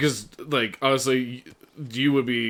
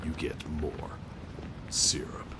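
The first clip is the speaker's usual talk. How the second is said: low, hushed movie trailer narration; horror trailer voice; 5 semitones lower, 4 dB quieter than usual. Pretend. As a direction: tease a horror sequel, low and soft. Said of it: "trailer voice".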